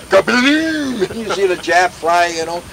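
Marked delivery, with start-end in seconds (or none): 0.1-1.1 high, drawn-out gun boom noise; imitated cannon blast. Veteran imitating tank fire, high vocal blast. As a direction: mimic a tank gun going off.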